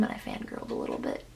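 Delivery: sheepish whispered confession. Real, not acted. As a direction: whisper it like a secret. Confessing fangirling.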